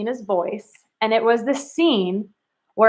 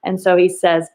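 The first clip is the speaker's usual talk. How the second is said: normal talking voice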